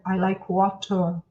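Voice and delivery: clipped British take from further back in the mouth; British English. Shown as a demonstration. Teacher contrasting accents for students, British accent. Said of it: "Eu vou falar isso no inglês mais britânico".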